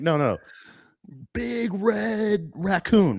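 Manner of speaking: high, slow drawn-out big red raccoon; awed raccoon. Retold or imitated. Raccoon telling his buddies, high and slow. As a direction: stretch it out in wonder. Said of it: "He's like".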